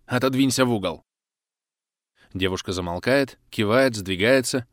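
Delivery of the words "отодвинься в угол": usual speaking voice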